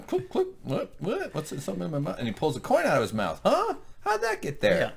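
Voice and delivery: high, puzzled mock alarm; confused character voice. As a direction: play the baffled man finding a coin in his mouth.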